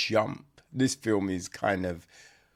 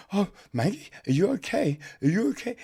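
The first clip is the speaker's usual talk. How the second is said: panicked husband; 9 semitones higher, much faster than usual. Retold or imitated. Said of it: "he's just like".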